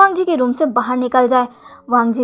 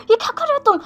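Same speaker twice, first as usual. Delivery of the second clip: much higher, alarmed hushing plea; panicked; 12 semitones higher, much faster than usual. A narrator's reading. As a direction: panic rising as he begs him to stop. Husband panics at the fake crying.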